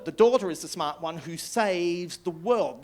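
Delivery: ordinary delivery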